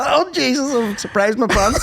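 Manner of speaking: high pitched laughing exclamation; words squeezed out through laughter. Real, not acted. Cracking up at his friend's fart joke, laughing hard.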